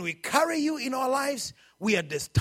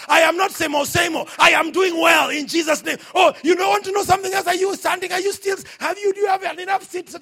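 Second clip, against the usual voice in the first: loud, high declaration of victory; full-force preaching; 6 semitones higher, 6 dB louder than usual. Acted. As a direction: preach it with rising force and pitch.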